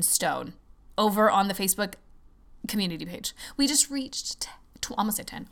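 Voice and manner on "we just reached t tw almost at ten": excited hushed whisper; breathless whisper of good news. Real, not acted.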